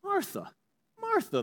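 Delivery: Jesus calling Martha by name gently; gentle calling. Acted. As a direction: call her name tenderly, lifting it. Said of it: "the Lord answered".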